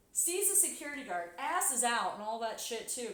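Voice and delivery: ordinary talk, nothing marked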